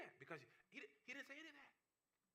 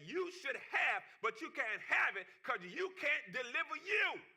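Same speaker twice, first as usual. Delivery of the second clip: rising, louder preaching cadence; full-force preaching; 5 semitones higher, 5 dB louder than usual. Acted. Preacher pressing the congregation about habits, loud and building. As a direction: build the sermon up and drive it home.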